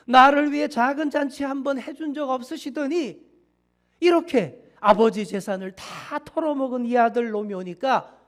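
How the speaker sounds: loud, indignant complaint to his father; elder son, furious at the feast, confronts his father; angry protest. Acted. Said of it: "아버지에게 이렇게 따집니다".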